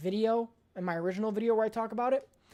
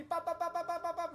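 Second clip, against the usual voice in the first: mock gunfire; 9 semitones higher, 8 dB quieter than usual. Imitating gunfire sounds.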